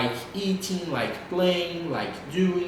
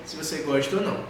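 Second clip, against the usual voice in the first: ordinary talk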